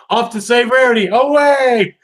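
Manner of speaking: high, loud heroic charge; heroic battle cry. Retold or imitated. Spike charging off to save Rarity, heroic shout. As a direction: launch the rescue like a cartoon hero. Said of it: "and it's like".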